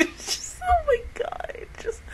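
tearful, breathy whisper rising high; choked whisper. Real, not acted. Fan overwhelmed by the death, whispering through tears. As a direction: barely get it out through tears.

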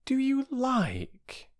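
high, very drawn-out hesitant question; doubtful. Acted. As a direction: stretch the words out, unsure. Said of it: "said Bobby doubtfully".

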